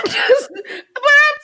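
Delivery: high laughing through words. Real, not acted. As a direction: squeal out laughing. Laughing fit mid-sentence.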